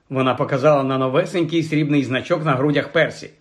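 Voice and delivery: ordinary delivery, nothing marked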